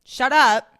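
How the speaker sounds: loud, high, exasperated outburst. Real, not acted. Podcaster telling TikTok defenders to stop, loud and exasperated. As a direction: tell them off, punching each word.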